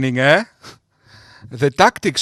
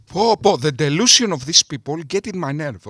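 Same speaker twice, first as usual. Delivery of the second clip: usual speaking voice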